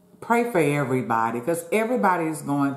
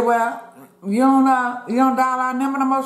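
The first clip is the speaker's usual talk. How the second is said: high, loud scolding question; indignant scolding; 8 semitones higher, 4 dB louder than usual. Retold or imitated. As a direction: confront her, half shouting. Retold quarrel with mother.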